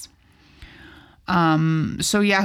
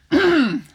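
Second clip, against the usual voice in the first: throat clearing; 10 semitones higher, 5 dB louder than usual. Real, not acted.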